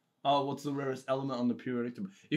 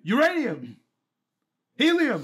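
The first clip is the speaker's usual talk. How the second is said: louder and higher, climbing on "Helium!"; shouted answers; 8 semitones higher, 6 dB louder than usual. Real, not acted. Contestant guessing at the quiz, shouting. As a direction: blurt each answer out.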